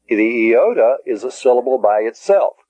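ordinary delivery

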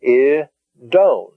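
"I, don" split with a clear gap; syllable by syllable. Shown as a demonstration. Teacher showing the two syllables of "I, don", syllable by syllable. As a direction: separate "I" from a drawn-out "don". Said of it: "That's a syllable by itself".

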